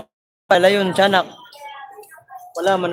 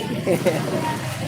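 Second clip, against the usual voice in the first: soft laugh; 7 dB quieter than usual. Real, not acted. Laughing after joke.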